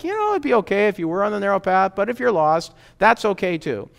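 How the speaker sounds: high-pitched, easygoing parody; mock passive indifference. Jesus as He is not, passively okay with the lost, mock indifference. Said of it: "He doesn't want you just passively like".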